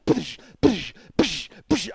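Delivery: explosive "psh" hits in rhythm; mouth-made smashing sound effects; imitated punching noises. Storyteller hitting it and hitting it, explosive impact noises.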